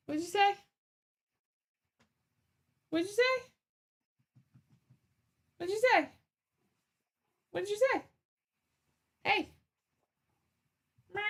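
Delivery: high pet voice, rising "say". Real, not acted. Baby talk to the cat.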